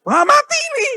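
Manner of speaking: very high, loud exclamation of dismay; dismayed outburst. Retold or imitated. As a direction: yelp it out as if doomed. Reacting in dismay to God's answer. (seruan kaget putus asa, melengking keras)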